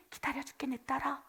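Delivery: put-on 기다려줄게 breathed gently to a child; tender. God soothing his complaining daughter, hushed tender promise.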